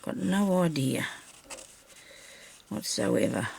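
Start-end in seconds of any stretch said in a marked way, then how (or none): none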